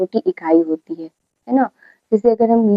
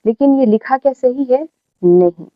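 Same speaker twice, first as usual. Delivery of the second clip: ordinary talk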